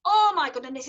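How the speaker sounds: "Oh my goodness" in easy Yorkshire with a flat O; Yorkshire accent. Shown as a demonstration. Teacher repeating her Yorkshire phrase in Yorkshire. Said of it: "that's how I'd say".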